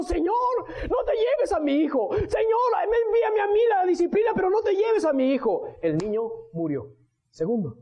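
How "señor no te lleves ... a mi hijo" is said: higher, desperate cry to the Lord; beg, rising to a shout on Señor; pleading prayer. Acted. David prays for his sick son, higher pleading cry.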